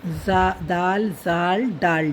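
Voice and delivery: दाल जाल डाल spoken slowly, each letter apart; slow teacher recitation; slow letter demo. Shown as a demonstration. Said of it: "दाल खाली जाल के ऊपर".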